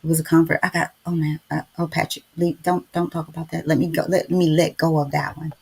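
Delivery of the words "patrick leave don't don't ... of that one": ordinary delivery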